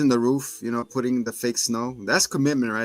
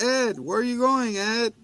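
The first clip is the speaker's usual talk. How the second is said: much higher pleading call; calling after him; 10 semitones higher than usual. Retold or imitated. Girl calling after Ed as he runs away, much higher. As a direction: call after someone running off. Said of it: "He starts running away like".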